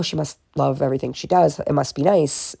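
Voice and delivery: ordinary talk, nothing marked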